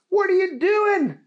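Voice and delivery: high, loud alarmed cry; startled by ketchup on the ceiling; shocked Booger. Acted.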